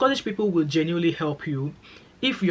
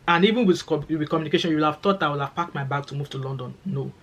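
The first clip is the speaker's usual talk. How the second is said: usual speaking voice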